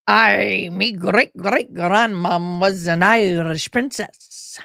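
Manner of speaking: slow put-on Irish lilt; mock Irish brag. She repeats her mock Irish princess boast to viewers, slow Irish. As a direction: play the Irish princess line broad and proud.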